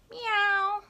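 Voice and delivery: high mewing; kitten meow. Retold or imitated. Reviewing the sounds, high kitten meow. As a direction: mew like a kitten. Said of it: "let's see if I got the sounds right".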